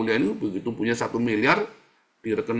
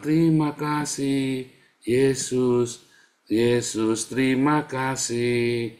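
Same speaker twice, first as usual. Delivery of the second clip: grateful prayer; 2 semitones higher, much slower than usual. Shown as a demonstration. Preacher modelling a morning thank-you prayer, slow and devout. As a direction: pray it slowly, savouring each word.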